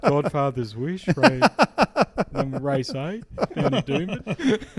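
slow, drawn-out Aussie bloke; laconic outback drawl. Retold or imitated. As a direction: drawl it like a punter on the phone. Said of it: "Appreciate the impersonation".

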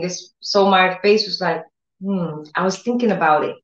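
usual speaking voice

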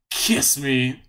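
imitating a creepy suitor; mock creepy request for a kiss; creepy come-on. Imitating Link asking for a kiss, mock creepy. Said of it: "so creepy when he says it".

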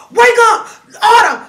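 high, loud alarm-raising yells; urgent shouting. Retold or imitated. Uncle waking the girls about a prowler, frantic shouting. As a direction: yell to rouse a sleeping house.

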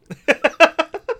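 high, loud burst of laughter; high-pitched real cackle of amusement. Real, not acted. Laughing at the co-host's quip.

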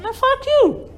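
very high, loud squawk; high-pitched acted fury; indignant outburst. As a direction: explode in mock outrage at the lawyer. Turns on lawyer in court.

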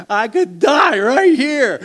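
high, loud and slow, stress on right; awed shout. Retold or imitated. Telling the church about the mountain drop, an awed shout. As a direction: shout it out in wonder. Retold thought on the cliff.

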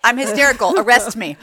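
high and loud, laughing through it. Real, not acted. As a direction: crack up while joking. Group laughing at word mix-up.

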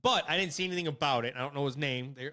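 ordinary talk, nothing marked

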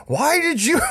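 high laughing cry; laughing shout. Real, not acted. Laughing while retelling question.

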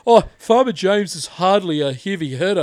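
mock impression of the smug commenter; snooty commenter voice. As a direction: parody a prissy know-it-all. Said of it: "he's in the fucking D M saying".

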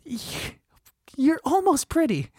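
high, hesitant mock encouragement; high-pitched pretend inner thought; thinking to himself. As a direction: lift it high, as if rooting for someone. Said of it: "thinking to myself".

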